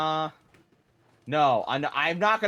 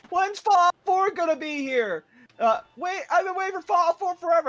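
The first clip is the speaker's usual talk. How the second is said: impatient fan voice; 5 dB louder than usual. Retold or imitated. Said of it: "people saying, like".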